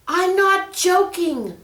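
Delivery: high, loud furious yell; mean it, yell at the monkey; angry shout. Acted. Peter yelling at the teasing monkey, angry and loud.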